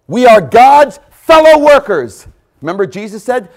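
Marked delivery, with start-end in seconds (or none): 0.1-2.3 "fellow" stretched, loud and high; louder second take. Shown as a demonstration. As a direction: say it again louder so they hear.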